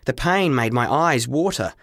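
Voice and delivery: ordinary delivery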